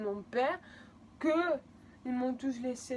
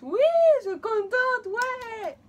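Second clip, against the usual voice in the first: high, loud mock-happy cheer; fake enthusiasm; 12 semitones higher, 12 dB louder, slower than usual. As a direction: exaggerate being thrilled, clearly not meaning it. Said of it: "Pas du tout". (fausse joie exagérée, voix aiguë et forte)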